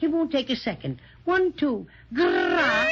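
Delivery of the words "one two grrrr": fierce little bear growl; special growl. Acted. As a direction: growl to scare the spider off. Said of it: "make one special growl at this spider".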